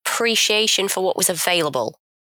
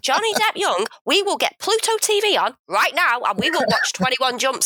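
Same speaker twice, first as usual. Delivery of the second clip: higher-pitched, emphatic parental comeback; mock indignation; 8 semitones higher than usual. Parent to daughter about young Johnny Depp, mock indignant. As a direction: lay down the law in mock outrage.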